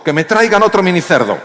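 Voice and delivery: high, loud boastful order; cocky demand. Retold or imitated. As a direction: call for another pig like a swaggering boss. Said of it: "Se vino arriba y dijo".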